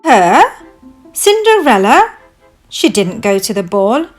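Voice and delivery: loud, incredulous questions; mild shriek. Acted. Stepmother and stepsisters doubting Cinderella, mildly shrieking. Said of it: "shrieked the stepmother and ugly stepsisters".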